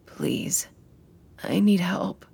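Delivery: rough, whispered gravel voice; gravelly voice. A narrator's reading. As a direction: rasp it out, barely voiced. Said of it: "her voice like gravel".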